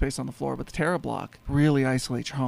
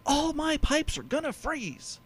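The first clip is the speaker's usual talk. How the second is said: higher and slow, mock fretting; worried old man; 12 semitones higher, slower than usual. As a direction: drag it out like a fussy old man. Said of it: "And he's like".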